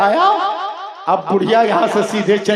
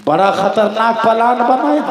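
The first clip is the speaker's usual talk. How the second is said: ordinary talk, nothing marked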